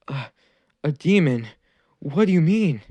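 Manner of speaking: put-on a boy hearing something terrible; stunned disbelief. Naruto asks the villain what he means, stunned. Naruto shocked at being called demon.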